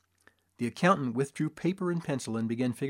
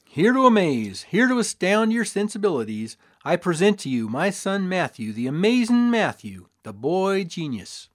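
loud, higher carnival barker; showman's pitch; 5 semitones higher, 5 dB louder than usual. A narrator's reading. Father pitches his son to the crowd, loud barker style. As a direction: sell the wonder to the crowd.

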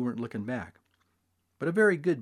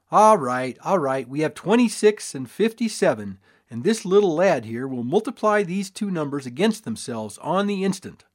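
showman's patter; 3 semitones higher, 6 dB louder than usual. A narrator's reading. Showman to crowd.

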